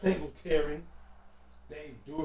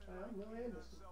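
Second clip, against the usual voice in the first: quick, softer take on a former player's voice; a light imitation of the player introducing himself; Neil Anderson impression; 4 semitones higher, 16 dB quieter than usual. Vlogger imitating an old Bears player's intro, lightly voiced and softer. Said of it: "That's a great impression".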